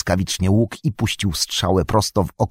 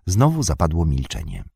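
ordinary delivery, nothing marked

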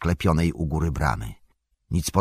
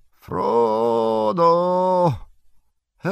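high, thin hail from far off; a weak cry barely carrying through fog; faint distant call; 11 semitones higher, much slower than usual. Acted. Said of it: "słabe, nikłe wołanie".

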